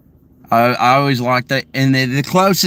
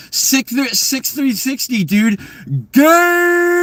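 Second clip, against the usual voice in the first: mock gamer yell; 11 semitones higher, slower than usual. Mimicking guys seen on a Razor, high mock yell. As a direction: imitate an over-hyped dude, pitched way up.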